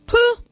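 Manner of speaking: viel höher und lauter, abwinkendes Puh; abfälliges Puh. A narrator's reading. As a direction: schnaub es geringschätzig hinaus. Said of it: "machte die Drossel". (die Drossel tut den Elf mit einem Puh ab)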